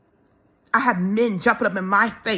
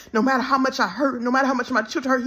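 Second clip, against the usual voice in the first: ordinary delivery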